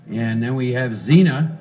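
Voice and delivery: normal talking voice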